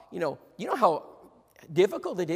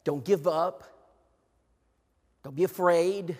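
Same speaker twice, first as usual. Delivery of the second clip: ordinary delivery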